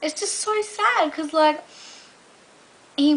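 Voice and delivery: pitying. Real, not acted. Pity for lonely man.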